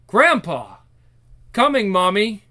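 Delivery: put-on loud child's call to family. Children calling out in story.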